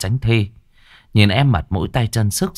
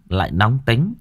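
plain reading voice

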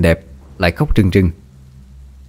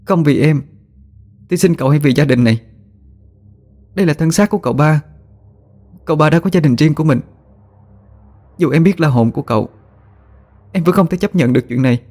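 high, pleading, firm refusal; beg, then refuse him with resolve; desperate resolve; 7 semitones higher than usual. A narrator's reading. Wife refuses her possessed husband, pleading but firm. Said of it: "quả quyết nói".

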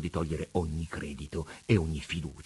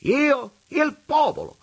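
high, loud mocking vagabond voice; wheedling rogue, raised and pitched high; vagabond Preola; 10 dB louder, slower than usual. A narrator's reading. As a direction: preach it like a sly tramp at God. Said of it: "rimbeccò il Preola".

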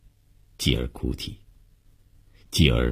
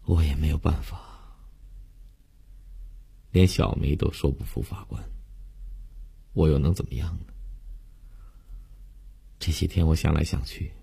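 soft, hushed and hopeless; quietly resigned to fate; numb resignation; 8 dB quieter than usual. A narrator's reading. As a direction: let the voice sink, as if given up. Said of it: "悠悠木然的神态".

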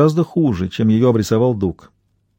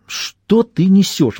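drawn-out, higher indignant question; mildly outraged, slow and pitched up; 6 semitones higher, much slower than usual. A narrator's reading. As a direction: stretch it out in affronted disbelief. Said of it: "возмутилась Элейн".

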